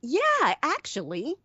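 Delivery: high-pitched acceptance; timid captive character. Acted. Prisoner answering good cop.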